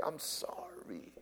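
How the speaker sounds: soft, breathy hushed sorry; a hushed I'm sorry; whispered apology. Acted. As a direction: whisper it as a plea for forgiveness. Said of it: "go to the person you offended and say".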